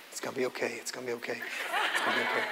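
soft, breathy reassurance to himself, half laughing; calm yourself down under your breath; hushed self pep talk. Retold or imitated. Introvert dad calming himself at the zoo gate, hushed.

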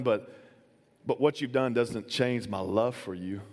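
low, quiet retold reply to a friend; calm low voice telling Cole he is still loved; tender. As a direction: speak softly and sincerely to the friend who left. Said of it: "I just looked at him and said".

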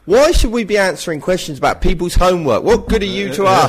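loud hammed-up rant, pitched high; mock rant. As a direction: rant at the students for a joke. Mock rant at student listeners.

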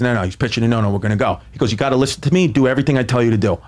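low, insistent dad; dad's voice. Retold or imitated.

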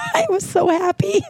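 laughing joy. Real, not acted. Delighted by friend's answer.

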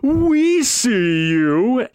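high, slow, eerie voice; eerie spectral whisper-call; ghostly voice. Acted. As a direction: draw it out like a haunting. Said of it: "You hear a voice behind you go".

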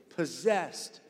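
ordinary talk, nothing marked

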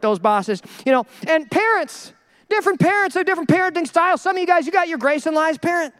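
usual speaking voice

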